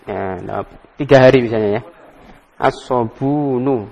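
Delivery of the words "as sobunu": slow model reading. Shown as a demonstration. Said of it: "Bacanya domah dulu".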